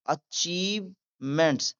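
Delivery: achievements slowly split in two, "achieve", "ments"; syllable by syllable. Shown as a demonstration. Teacher demonstrating the word to learners, syllable by syllable. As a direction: draw out "achievements" with a break inside. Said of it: "आगे पढ़ते हैं".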